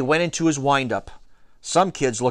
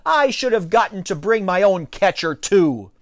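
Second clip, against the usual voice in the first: higher, louder complaint; protesting; 7 semitones higher, 3 dB louder than usual. A narrator's reading. Alex wanting his own catcher, protesting.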